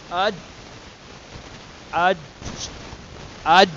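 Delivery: اج with the voice cut at ج; each اج ends in a firm closure; stopped jeem. Shown as a demonstration. Teacher repeating the stopped jeem for students, اج held shut. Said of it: "آواز نہیں نکلے گی اس آگے".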